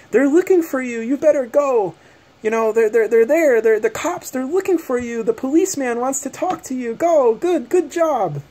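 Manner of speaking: high, sing-song mock sweetness; mocking the neighbor lady's fake-sweet warning; sinister taunting lady. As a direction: imitate her gloating, sugary threat. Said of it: "the most sinister-looking smile on her face".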